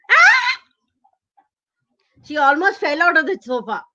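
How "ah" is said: very high short scream. Acted. Startled grandmother nearly falling off sofa, high scream. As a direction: shriek in sudden fright. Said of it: "And she screamed".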